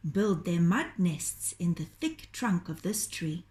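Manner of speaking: usual speaking voice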